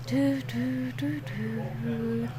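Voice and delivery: ordinary talk, nothing marked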